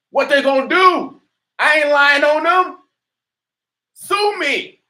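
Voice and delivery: defiant shout. Real, not acted. To the overseers, a loud, high, defiant dare. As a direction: shout the challenge straight at them.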